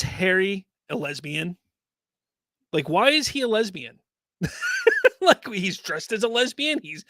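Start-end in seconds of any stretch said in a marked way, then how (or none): 4.4-5.2 a sudden high giggle; high laugh. Real, not acted. Laughs at the trailer. 5.2-6.8 laughing. Real, not acted. Laughing while mocking trailer.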